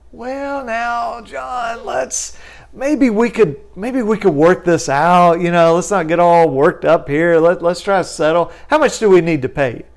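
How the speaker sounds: collector's lawyer scrambling to settle; nervous backpedaling lawyer. Retold or imitated. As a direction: soothe him and offer money.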